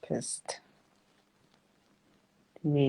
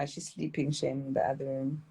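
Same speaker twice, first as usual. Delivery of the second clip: ordinary delivery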